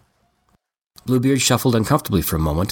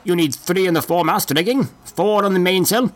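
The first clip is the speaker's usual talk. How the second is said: put-on old man voice; 8 semitones higher than usual. Bart inspects the ship.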